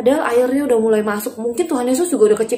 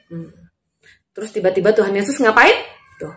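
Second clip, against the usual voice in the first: usual speaking voice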